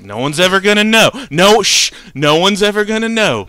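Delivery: mock-urgent cover-up pledge; acted insistent reassurance. Acted hushing of panicked stepdad in dream.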